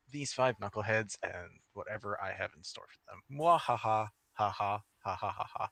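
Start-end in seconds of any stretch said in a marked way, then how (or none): 3.4-5.7 flat deadpan villain cackle; monotone evil laugh. Acted. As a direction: laugh like a bored mad scientist. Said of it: "the monotone evil laughter".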